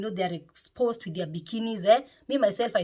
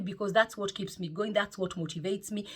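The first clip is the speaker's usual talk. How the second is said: normal talking voice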